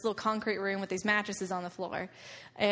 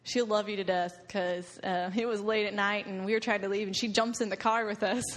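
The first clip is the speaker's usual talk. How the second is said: usual speaking voice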